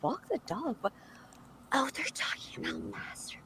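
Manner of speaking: whispered run-through of a script; under the breath. Retold or imitated. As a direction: act it out in a hushed, secretive whisper. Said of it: "under my breath out loud".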